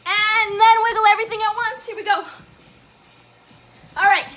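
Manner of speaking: high, loud, fast hype calls; excited countdown. Real, not acted. Host pumping up viewers for the final wiggle, excited shouting. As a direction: pump everyone up to the finish.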